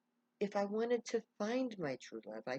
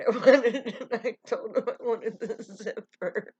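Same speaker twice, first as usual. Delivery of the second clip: high, loud, laughing through the words; starts laughing; 5 semitones higher, 13 dB louder than usual. Retold or imitated. Man explains his ride choice to her, helpless loud laughter. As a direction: crack up while explaining. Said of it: "he just starts laughing".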